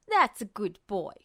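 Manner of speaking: low, mocking and patronizing; condescending villain. Justin mocks Ed like a dog, low and patronizing. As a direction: pat him down with words. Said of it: "he said".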